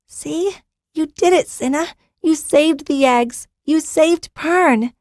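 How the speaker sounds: put-on high, slow little girl; high proud child, slowly; young girl voice. Young girl praises Zinna.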